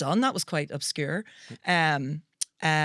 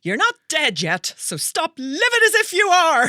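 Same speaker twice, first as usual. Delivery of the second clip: dramatic movie quote; 9 semitones higher, 8 dB louder than usual. Acted. Quoting a [name] film line.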